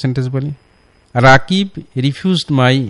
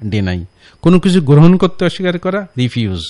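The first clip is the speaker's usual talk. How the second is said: ordinary delivery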